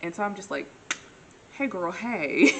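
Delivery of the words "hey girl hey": loud, drawn-out playful greeting; hammed-up greeting. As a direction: call it out slowly, stretching each word. Acts out her greeting to a friend.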